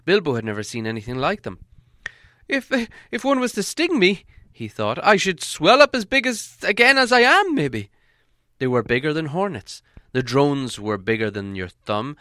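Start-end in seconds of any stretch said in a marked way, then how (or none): 2.5-4.2 high-pitched, hesitant little hobbit; nervous hobbit. A narrator's reading. Hobbit eyeing giant bees, high and anxious. Voices the hobbit's worried thought. 5.0-7.9 high, loud hobbit fretting; nervous hobbit. A narrator's reading. Hobbit afraid of being stung, high and anxious. Voices the hobbit's worried thought.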